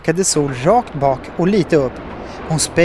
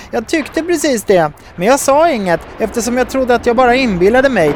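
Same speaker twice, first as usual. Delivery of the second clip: high-pitched reply across a distance; calling back; 8 semitones higher than usual. A narrator's reading. Nova on the boat answering Sol overhead, calling back. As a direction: call back in a much higher voice. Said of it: "ropade Nova tillbaka".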